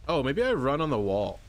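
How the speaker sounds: normal talking voice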